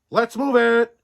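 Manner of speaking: loud, high yell to the crew; rally the crew with a yell. A narrator's reading. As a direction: bark the order loud and high. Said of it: "yelled Postmaster Buck Growlerson".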